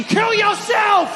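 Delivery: slow furious scream, hammed-up; outraged shout. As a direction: explode at the absurd order. Outraged comic outburst.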